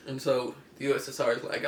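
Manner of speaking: normal talking voice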